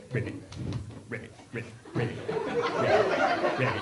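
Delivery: ribbit said as a playful frog croak. Retold or imitated. Comedian mimicking frogs at a tribute band. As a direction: imitate a frog going ribbit. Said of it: "they're all like".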